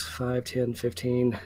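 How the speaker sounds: unvoiced, slow, soft count; whispered counting. Real, not acted. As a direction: count it under your breath. GM counting squares quietly.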